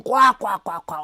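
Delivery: mock laugh spelt out in barks; imitated guffaw. Reactor mimicking two friends laughing, mock guffaw. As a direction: imitate men cracking up, kwa by kwa. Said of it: "when they laughing".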